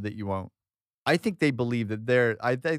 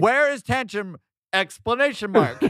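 higher, louder mock radio call; mock walkie-talkie call; 7 semitones higher, 4 dB louder than usual. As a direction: bark it into a walkie-talkie with the punctuation spoken. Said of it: "they would say question mark".

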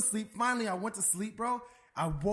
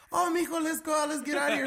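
high, louder mock-mom voice; imitated fussing mom; 7 semitones higher, 4 dB louder than usual. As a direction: pitch it way up as a worried mom. Mom's reply voiced in story.

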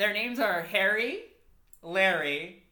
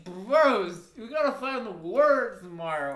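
lightly hammed-up drunk soldier; a hint of a wasted soldier rallying the boys; 4 dB louder than usual. Tipsy soldier urging his best friends on to war, hammed-up.

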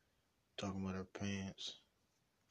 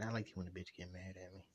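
ordinary delivery

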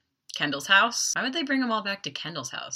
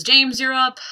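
raised, higher call; 8 semitones higher, 9 dB louder than usual. Retold or imitated. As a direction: call the next person up. Casting caller summons next auditioner.

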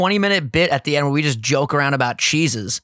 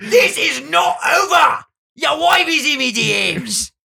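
put-on shouted slow Irish accent; 12 semitones higher, 3 dB louder, slower than usual. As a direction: roar it in an Irish brogue. McGregor quote, shouted, far voice.

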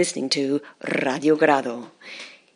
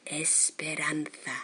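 whispering; 3 semitones lower, 14 dB quieter, slower than usual. Acted. Dramatic hushed phrase.

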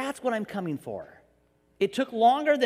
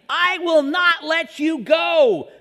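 louder, higher, slow passionate declaration; fervent God; 7 semitones higher, 8 dB louder, slower than usual. Retold or imitated. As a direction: declare it with full fervour. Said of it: "he kept coming after us saying".

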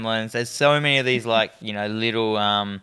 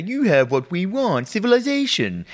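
talking brain gremlin; 6 semitones higher than usual. Retold or imitated. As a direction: imitate a grandly articulate gremlin. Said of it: "how he says it".

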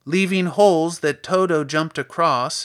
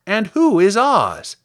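high, loud scarecrow question; curious scarecrow; 7 semitones higher, 9 dB louder, slower than usual. Acted. Scarecrow asks the girl her destination, curious. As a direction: pipe up inquisitively.

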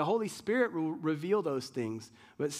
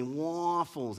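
mimicked American waffles; 2 semitones lower than usual. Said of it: "They're like".

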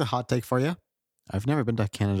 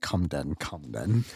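low mock challenge; 7 semitones lower, 4 dB quieter, slower than usual. Challenging friend jokingly.

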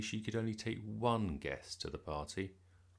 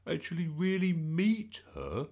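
amazed; 9 semitones higher, 4 dB louder than usual. A narrator's reading. Bertie amazed.

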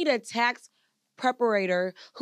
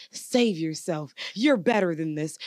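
slow, lower, lecturing man; mock a man's advice, slowly; preachy man voice; 6 semitones lower, slower than usual. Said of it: "a man telling us".

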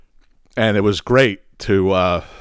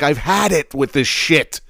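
slow, dragged-out disgust; fed up; 5 semitones higher, slower than usual. Retold or imitated. Boy sick of moving schools, slow disgusted complaint. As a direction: drag it out, sick of it. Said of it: "I'm like".

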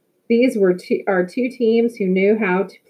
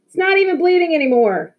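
protesting; 10 semitones higher, 4 dB louder than usual. A narrator's reading. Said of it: "Victor continued to protest".

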